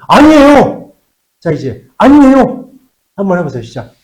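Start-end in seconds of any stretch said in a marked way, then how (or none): 0.1-0.9 lightly mimicked students, louder and higher; a hint of a class calling out; students answering. Teacher imitates students answering his negation question, mock loud reply. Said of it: "그랬더니 너, 너희들이". 2.0-2.7 lightly mimicked students, louder and higher; a hint of a class calling out; students answering. Teacher imitates students answering his negation question, mock loud reply. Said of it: "그랬더니 너, 너희들이".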